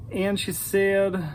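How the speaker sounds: usual speaking voice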